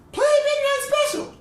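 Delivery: high, loud mock holler; woman fan yelling. Woman in the audience requesting a song, high yell. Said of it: "people to yell things from the audience".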